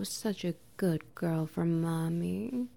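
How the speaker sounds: ordinary delivery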